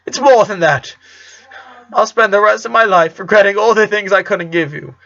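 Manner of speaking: a father moaning over his regrets. Acted. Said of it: "he moaned".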